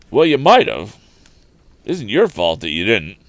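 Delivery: loud, surly retort; gruff tramp. Acted. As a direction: bark it back, still sore. Tramp snaps back at Tom.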